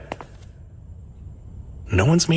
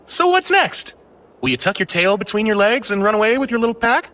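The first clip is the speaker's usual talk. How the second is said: mocking taunt; 6 semitones higher than usual. Taunting the defeated attacker.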